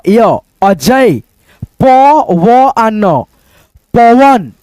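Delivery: य, अजय drawn out very slowly; teacher sounding out य, अजय syllable by syllable; slow blending demo. Shown as a demonstration. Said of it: "तीनों को जोड़ दो". (य, अजय धीरे-धीरे जोड़कर)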